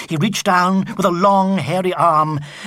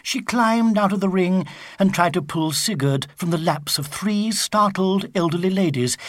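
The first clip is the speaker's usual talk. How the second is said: ordinary delivery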